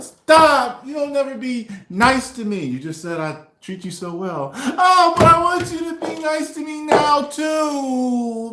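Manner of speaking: high whiny complaining voice; girlfriend voice. Retold or imitated. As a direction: whine it like the girlfriend, loud.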